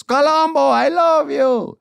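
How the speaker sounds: put-on very high, loud, drawn-out Puerto Rican accent; accented grandma's gushing. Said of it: "it's very hard not to laugh at her accent".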